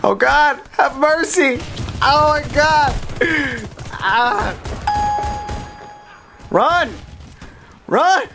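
high, loud panic under fire; panicked. Real, not acted. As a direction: cry out high as danger closes in.

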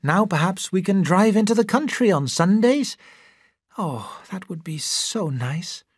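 hopeful wife, softening and slowing at the end; dreamy wish for country Sundays; wife happily dreaming aloud. A narrator's reading. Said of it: "She said happily".

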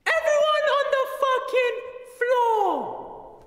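a robber ordering everyone to the floor, screaming; all-out shout. Acted. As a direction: scream the line with everything you have. Final take, shouted with effort.

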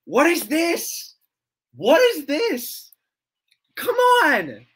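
high, drawn-out cries of protest; exasperated yelling. Real, not acted. Streamer protesting at the heckling in chat, high exasperated yell. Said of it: "why I'm yelling".

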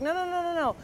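high, quick burst of placating reassurance; hurried, high-pitched pleading with reason; frantic rationalizing. Retold or imitated. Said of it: "you're just trying to rationalize with them".

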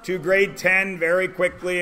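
loud, booming call to attention; loud announcer call to the class; announcing to class. Real, not acted. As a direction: project it to the whole room like a ringmaster. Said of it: "class announcement".

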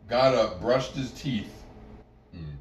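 ordinary delivery

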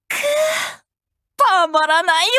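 high, savoring the thrill; a gleeful little shiver of delight; thrilled relish. Acted. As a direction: let the satisfaction burst out.

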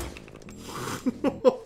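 breathy high nervous laugh; laughing off a fright. Real, not acted. Rire nerveux après la peur. (rire nerveux soufflé)

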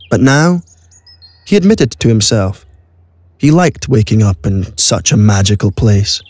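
normal talking voice

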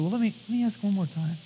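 soft, scheming half-murmur; greedy prophet murmuring to himself; sly quiet aside. Retold or imitated. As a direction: talk yourself into it quietly. Greedy Balaam eyeing gold.

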